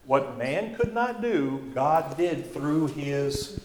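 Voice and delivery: coaxing fill-in-the-blank, soft and slow; slow, quiet prompt. Shown as a demonstration. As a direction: drop the voice and drag it out so they fill in the last word.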